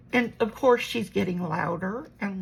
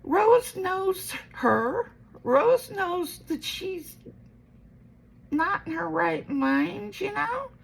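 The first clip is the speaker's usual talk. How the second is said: much higher and slow, spelling it out; slow insistent; 9 semitones higher, slower than usual. Retold or imitated. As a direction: lay each word down slowly and firmly. Retells arguing with her mom.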